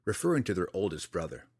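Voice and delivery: ordinary delivery, nothing marked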